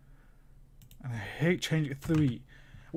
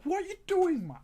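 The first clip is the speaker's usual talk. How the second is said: exasperated yell; 13 semitones higher, 5 dB louder than usual. Real, not acted. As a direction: pitch leaps way up on doing, louder. Yelling at player on screen.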